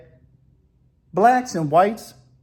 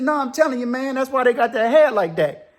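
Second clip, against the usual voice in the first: insistent; 8 semitones higher, 3 dB louder than usual. Retold or imitated. Acted friendly argument, insisting.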